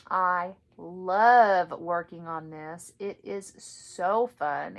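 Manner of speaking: gushing delight. Real, not acted. Gushing about favourite project.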